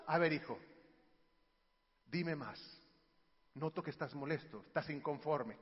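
low, soft, patient listening voice; calm parent. Acted. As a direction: gently draw the child out.

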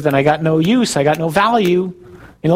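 ordinary talk

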